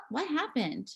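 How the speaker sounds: normal talking voice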